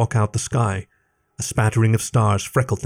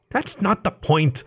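slow, pressed retort, higher pitch; hissed; 6 semitones higher, slower than usual. A narrator's reading. Alec snapping at Leif in the dark: hissed. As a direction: hiss it through your teeth. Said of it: "I hissed".

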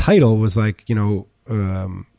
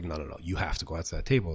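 soft, quick self-talk under the breath; talking himself into going to the table, quietly; inner voice; 6 semitones lower, 12 dB quieter, faster than usual. Retold or imitated. Chef telling himself to face the guest, soft and quick. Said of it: "I was like".

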